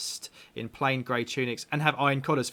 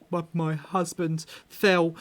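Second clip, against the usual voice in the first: mock crying; 5 semitones higher, 4 dB louder, slower than usual. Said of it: "She's crying. She's like".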